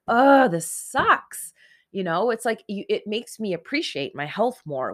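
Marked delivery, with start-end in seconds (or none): 0.1-1.5 loud, high, slow fed-up complaint; hammed-up grumble about being ill; annoyed groan. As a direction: groan it out, sick and sick of it. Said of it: "I'm like".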